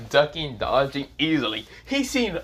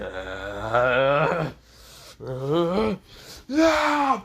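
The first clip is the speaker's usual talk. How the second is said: power-up cry; much slower than usual. Acted. Asta charging up to go super, rising power-up cry.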